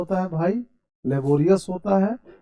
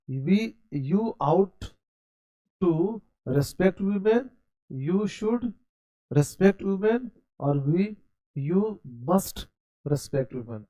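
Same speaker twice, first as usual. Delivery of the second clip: slow model sentence; 3 semitones higher, much slower than usual. Shown as a demonstration. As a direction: model it slowly for the class. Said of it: "एक उच्चारण सीखते चलिएगा". (धीमा, ऊँचा शिक्षक स्वर)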